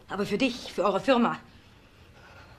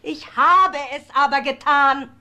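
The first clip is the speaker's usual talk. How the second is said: louder, slow defiance; defiant; 3 semitones higher, 6 dB louder than usual. Acted. Wife to her shocked family, defiant and slow. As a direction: spit it out slowly, unrepentant.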